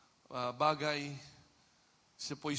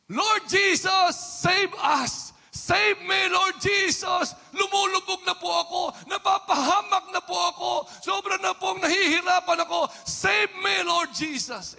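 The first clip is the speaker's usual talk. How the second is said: much higher, much louder desperate shouting prayer; crying out to the Lord; 13 dB louder than usual. Shown as a demonstration. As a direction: cry out as if drowning, slow on Lord Jesus. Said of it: "Isang sigaw, isang iyak". (sumisigaw na panalangin ng saklolo)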